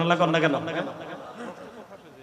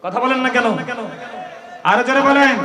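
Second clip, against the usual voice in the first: louder, higher call to the crowd; urging call; 3 semitones higher, 7 dB louder than usual. Real, not acted. Preacher urging the crowd to shout back, loud call. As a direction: push them to answer louder.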